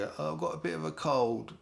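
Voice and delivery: mock sick voice. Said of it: "rang up your boss and said".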